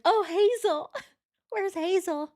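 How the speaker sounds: high-pitched hammed-up wail; mock crying woman. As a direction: squeal it like a fake sob. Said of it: "she's like".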